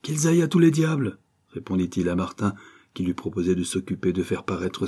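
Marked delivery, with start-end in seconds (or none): 0.0-1.2 higher, scornful dismissal; scornful curse. Acted. Poet refusing to be published, scornful. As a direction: wave the whole lot away with a curse.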